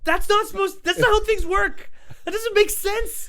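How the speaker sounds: very high, loud retold outburst; exasperated mock protest. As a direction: squawk in hammed-up disbelief. Acting out own baffled protest.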